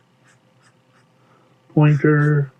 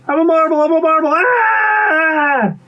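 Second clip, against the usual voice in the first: very high monotone wailing scream; panicked pea. Acted. Pea pretends to be a marble before bird, screaming in panic. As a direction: shriek it, running into a long "ah".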